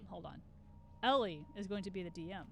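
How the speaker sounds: ordinary talk, nothing marked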